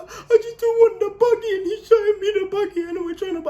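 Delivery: high, loud mock crying; hammed-up sobbing whine; imitated tearful complaint. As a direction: wail it all out in a blubbering rush.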